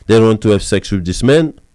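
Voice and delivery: ordinary delivery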